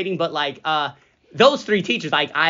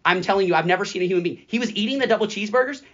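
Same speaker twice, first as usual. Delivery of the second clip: ordinary delivery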